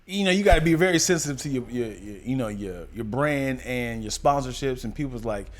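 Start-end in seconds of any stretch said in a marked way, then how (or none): none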